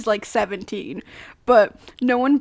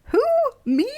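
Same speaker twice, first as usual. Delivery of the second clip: innocent; 5 dB louder than usual. A narrator's reading. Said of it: "he asked innocently".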